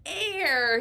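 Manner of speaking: playful stand-in for the class shouting; pretend child shout. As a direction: call it out like an eager pupil. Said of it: "Say it really loudly".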